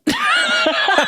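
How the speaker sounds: sudden high-pitched laugh bursting out; burst of laughter. Real, not acted. Friend laughing while recalling her husband's blunt advice, a sudden high laugh. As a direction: crack up laughing at the memory.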